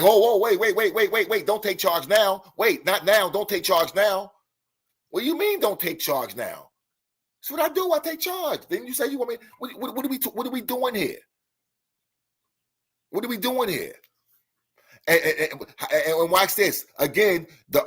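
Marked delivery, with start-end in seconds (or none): 0.0-4.3 high, mock-panicked protest; alarmed objection. Acted objection to someone taking charge. 5.1-13.9 high-pitched, incredulous comeback; baffled protest. Acted. Acted baffled reply to that objection.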